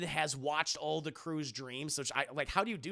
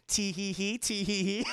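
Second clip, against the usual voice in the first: gleeful put-on little giggle; imitated sneaky tee-hee; mock villain titter; 3 semitones higher than usual. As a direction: titter like a gloating sidekick. Imitating David gloating in background.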